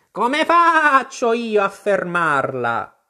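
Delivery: high, slow, bleating-long vowels, loud; an exasperated sheep stretching out every stressed vowel; scolding sheep. Acted. The sheep scolds Alice for spinning round, high drawn-out scolding.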